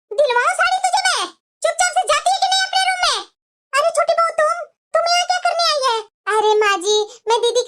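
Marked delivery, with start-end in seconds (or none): 0.1-6.0 put-on higher, scolding old-woman voice; angry mother-in-law. Mother-in-law refusing the elder bahu a market trip, scolding. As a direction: snap at her like a strict saas.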